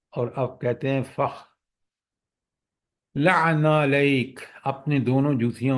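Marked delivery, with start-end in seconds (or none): 1.0-4.4 slow, measured Arabic recitation; Quranic recitation. Shown as a demonstration. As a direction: recite the verse slowly. Reciting Quran verse in lesson. (آہستہ قرآنی تلاوت)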